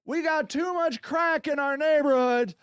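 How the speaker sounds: a hint of acted public appeal; lightly voiced community plea.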